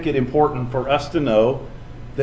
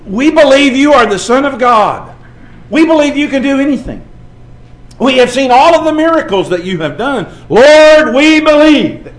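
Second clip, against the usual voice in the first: fervent confession; 10 semitones higher, 9 dB louder than usual. Acted. Preacher voicing the confession Jesus wanted from Philip, fervent and loud. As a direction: proclaim it like a prayer of faith.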